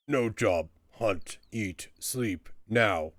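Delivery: choppy, primitive troll speech; sulky caged troll. Acted. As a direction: grunt one word at a time like a troll. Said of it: "Troll, I mean".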